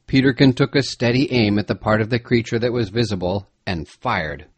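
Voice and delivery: usual speaking voice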